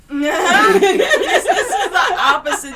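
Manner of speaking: loud, high laughing protest. Real, not acted. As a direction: laugh while correcting him.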